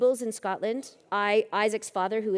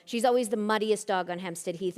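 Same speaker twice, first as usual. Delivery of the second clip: usual speaking voice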